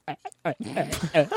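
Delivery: grunting through a stuffed mouth; cheeks stuffed full. Retold or imitated. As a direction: mouth crammed with marshmallows. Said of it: "how many marshmallows can you just shove down your gullet".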